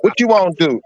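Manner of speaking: British speaker attempting American country; country accent. Shown as a demonstration. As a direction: put on a hammed-up country accent. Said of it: "do your country accent".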